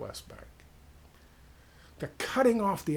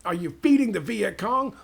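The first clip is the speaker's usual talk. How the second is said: higher, louder accusing yell; mock yelling soldier; 6 semitones higher, 8 dB louder than usual. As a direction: bark the accusation at the villager. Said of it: "they start yelling at him".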